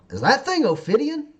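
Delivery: pitched high and loud, startled; alarmed question. Acted. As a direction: blurt it out in alarm. GM voices Duke Russell, alarmed.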